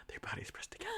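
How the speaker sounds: whispered, high and hushed like a romance novel; mock breathy romance. Host mocks the romantic dance scene in a whisper. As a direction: swoon it in a whisper.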